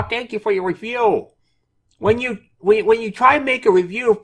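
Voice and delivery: high-pitched, loud mock developer voice; developer's voice. Reading the developer's reply to viewers in a high, loud put-on voice. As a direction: read it out in an exaggerated high voice. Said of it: "try to read this in his, in his voice".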